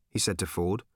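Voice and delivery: plain reading voice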